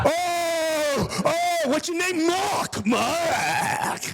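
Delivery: high, drawn-out mocking shout; confrontational yell. Passenger demands the worker's name, yelling. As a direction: stretch each word out in a high yell.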